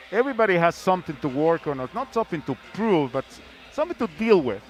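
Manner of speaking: ordinary talk, nothing marked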